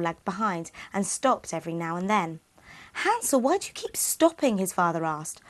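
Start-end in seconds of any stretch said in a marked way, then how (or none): 3.0-4.6 father's question. Acted. Father voiced in a story.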